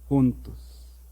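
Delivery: ordinary delivery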